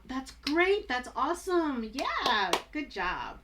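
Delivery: high, loud excited praise; genuine enthusiasm. Shown as a demonstration. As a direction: cheer them on as if it's really awesome. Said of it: "if something is really aw".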